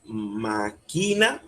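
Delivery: "máquina" said as ma-QUI-na, slow, rising; wrong stress on QUI. Shown as a demonstration. Teacher shows what we don't say, "máquina" stressed on QUI. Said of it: "Nosotros no decimos".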